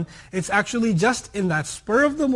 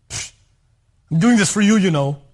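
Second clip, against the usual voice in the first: louder, scoffing grumble; grumbling anger; 2 semitones higher, 6 dB louder than usual. Retold or imitated. Angry spouse driving partner to dinner, resentful and loud. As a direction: huff it out resentfully.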